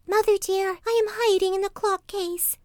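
put-on very high little kid's voice; youngest kid calling. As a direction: call to mother in a tiny high voice. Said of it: "a soft voice cried out".

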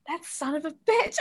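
retold disbelief at a smooth liar; a touch of playful indignation; mock outrage. Own thought, mock outrage.

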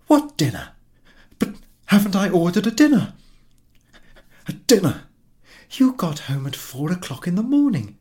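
high, loud exasperated retort; wife reproaching husband over dinner; indignant wife. Acted. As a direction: she scolds him for forgetting.

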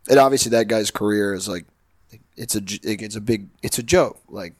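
ordinary delivery

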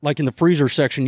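usual speaking voice

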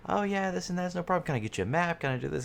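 high, chirpy helpful staff voice; upbeat theme park guest services voice; customer service voice. Retold or imitated. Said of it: "guest service voice gets it, one octave higher".

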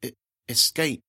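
halting strange little voice; stammering girl. Acted. The girl warning Amanda in a stammering odd voice. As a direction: stutter out the warning.

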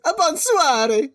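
high, drawn-out Italian lilt; slow, hammed-up Italian sing-song; mock Italian-French accent. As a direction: imitate French said with exaggerated Italian inflection. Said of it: "your inflection was so Italian".

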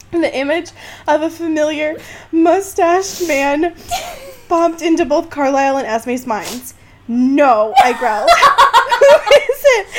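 high, loud giggling reading; laughing while reading. Real, not acted. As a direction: fight through the laughter. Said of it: "I'm literally sobbing right now".

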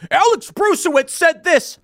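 high, loud mock excuse; mocking Bill. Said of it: "And he goes".

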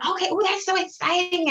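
high-pitched gush of excitement; mock little-kid delight; imitating excited kids. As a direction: act out thrilled children. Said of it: "and they were like".

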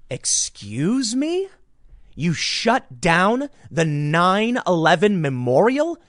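high, slow, disbelieving excuse me; incredulous. Real, not acted. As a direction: stretch it out in stunned outrage. Outraged disbelief at cancellation.

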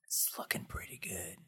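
breathy hushed whisper. Real, not acted. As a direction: drop to a secret whisper. Teasing answer about upcoming Buffy.

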